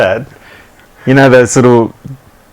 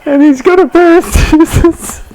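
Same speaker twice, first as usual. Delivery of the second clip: high, slow words squeezed through laughter; can barely get it out for laughing; laughing while talking. Real, not acted.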